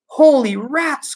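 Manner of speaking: slow, high astonished cry; cried out. Acted. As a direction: gasp it out, stunned. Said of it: "cried Shanks".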